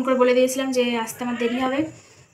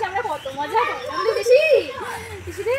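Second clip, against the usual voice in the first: mock wail with PC rising at the end; half-joking crying lament to auntie; a hammed-up tearful goodbye; sing-song lament; 7 semitones higher than usual. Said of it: "একটা সুরেই কাঁদা হচ্ছে".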